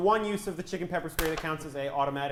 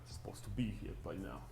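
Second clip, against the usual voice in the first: put-on Russian accent; 4 semitones lower than usual. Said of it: "Russian accents".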